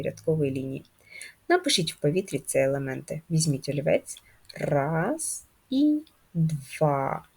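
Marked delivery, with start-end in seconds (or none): 4.6-7.2 very slow, drawn-out counting with pauses; teacher counting along with slow writing movements; slow stroke count. Shown as a demonstration. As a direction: stretch each word to last a pen stroke. Counting strokes while writing in air.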